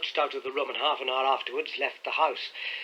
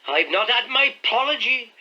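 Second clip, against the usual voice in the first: sulky; 10 semitones higher, 7 dB louder than usual. A narrator's reading. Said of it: "said he sulkily".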